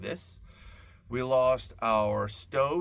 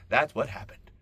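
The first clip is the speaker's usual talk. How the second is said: ordinary delivery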